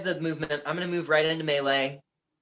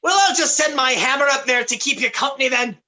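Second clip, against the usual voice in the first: high, fast, dry jab; sarcastic cleric; 8 semitones higher, much faster than usual. Acted. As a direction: needle him with a smirk. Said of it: "looks askance at this and says".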